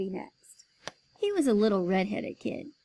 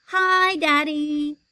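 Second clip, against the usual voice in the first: put-on toddler voice; 6 semitones higher, 8 dB louder, much slower than usual. Toddler greets father.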